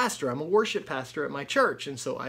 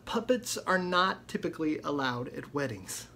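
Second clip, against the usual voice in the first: ordinary talk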